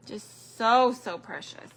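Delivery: usual speaking voice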